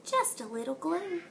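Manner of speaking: soft hushed aside; whispered secret. Acted. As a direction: share it like a little secret. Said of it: "Missus Moose winked and then whispered".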